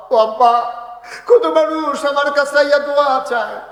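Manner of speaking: high, loud plaintive call; crying out. Retold or imitated. Son at his father's grave calling to him, loud lament.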